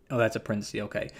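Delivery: normal talking voice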